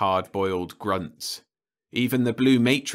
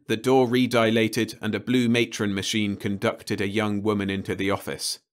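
usual speaking voice

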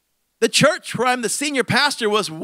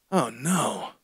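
mock dread; 8 semitones lower, 7 dB quieter than usual. Dread as he speaks.

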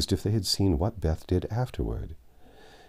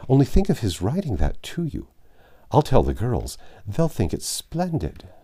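lively awe, voice lifting higher; impressed; 6 semitones higher, 4 dB louder than usual. A narrator's reading. Amy to her sister about the old gentleman's note, impressed. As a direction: gush a little, drawing out the praise. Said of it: "much impressed by the note".

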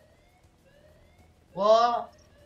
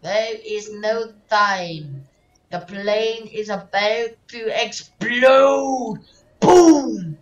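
slow, loud words building to a booming shout; dramatic urgent warning; 6 semitones higher, 12 dB louder, much slower than usual. Acted. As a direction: stretch it out and build to the blast. Acted warning of an explosion.

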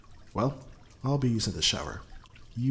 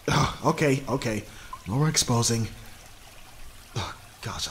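flustered; 3 semitones higher, 3 dB louder than usual. Acted. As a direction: wave it off loudly after the cough. Coughing fit after teasing partner.

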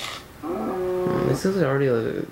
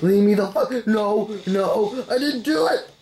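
ordinary talk, nothing marked

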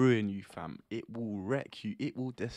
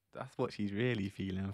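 ordinary talk, nothing marked

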